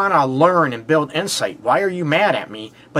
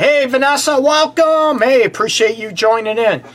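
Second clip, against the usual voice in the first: bright greeting; 9 semitones higher, 4 dB louder than usual. Real, not acted. Greeting a caller live.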